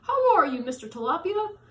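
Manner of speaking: high, loud sing-song hello; cheery greeting. Acted. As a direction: call out a bright hello. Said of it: "asked Mister Seahorse".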